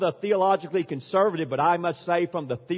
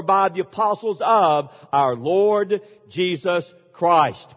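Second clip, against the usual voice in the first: scripture reading; 5 dB louder than usual. Acted. Reads scripture aloud.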